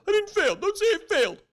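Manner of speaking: whiny, offended mimicry of a young person; indignant kid.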